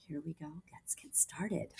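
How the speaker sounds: hushed start before reading; soft whispered opening. Real, not acted. Teacher whispering to the class before the story, hushed.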